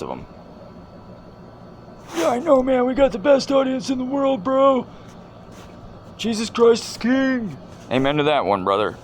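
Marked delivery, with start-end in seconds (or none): 2.2-4.9 squeaky high dude voice, much higher and louder; cartoonish high-pitched buddy hello; high mock greeting. Voices producer Joe again. 6.2-7.6 high put-on voice, slow and declaring; much higher, drawn-out declaration; slow high proclamation. Producer voice proclaims.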